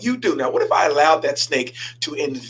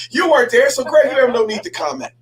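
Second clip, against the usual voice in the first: playful jab; 9 semitones higher than usual. Real, not acted. Pastor teasing Greg in the congregation, playful jab. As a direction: rib him with a laugh.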